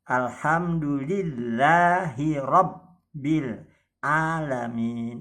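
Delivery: Alhamdulillahi Rabbil Alamin slow, ll and bb held; held doubled letters. Shown as a demonstration. Teacher reciting for students, doubles held. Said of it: "ditahan sejenak dan agak ditekan".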